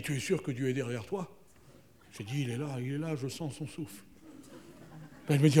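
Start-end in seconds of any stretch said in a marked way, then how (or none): 0.0-4.0 hushed. Pretend. Dialogue chuchoté avant le sacrifice.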